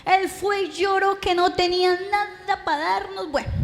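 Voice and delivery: normal talking voice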